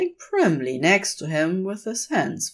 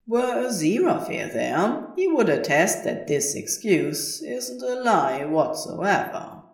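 usual speaking voice

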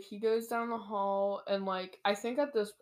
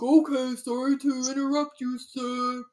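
raised, hammed-up apology; comically deferential guard backing out; silly guard voice; 3 semitones higher, 6 dB louder than usual. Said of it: "I don't know why I made him talk like".

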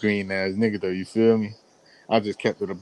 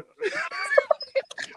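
laugh. Real, not acted. Laughing about no Subway.

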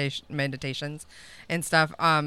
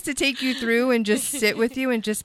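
soft ASMR voice; 4 semitones higher, 5 dB louder than usual. Shown as a demonstration. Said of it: "real soft".

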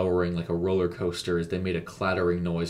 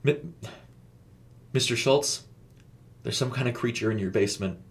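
mildly panicked, hesitant start; a touch of panic, careful not to be overheard; falter, then report it urgently; panicked but hushed; 3 semitones higher than usual. A narrator's reading. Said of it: "Casey said in a panicked tone, careful not to speak so loudly".

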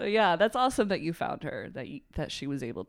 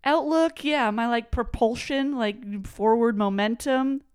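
excited; 8 semitones higher, 11 dB louder than usual. Real, not acted. Excited word hunt with cohost.

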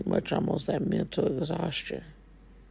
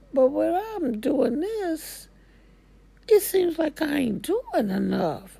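much higher, fed-up venting; exasperated inner voice; 11 semitones higher, 3 dB louder than usual. Acted. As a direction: pitch it up like a worn-out mom listing her load. Exhausted person voicing inner frustration.

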